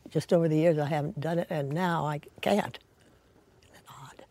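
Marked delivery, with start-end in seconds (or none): 3.6-4.2 near-whispered wondering aside; hushed musing. Real, not acted. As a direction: drop to a soft, lower whisper. Musing on her own aversion.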